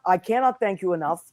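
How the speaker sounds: ordinary talk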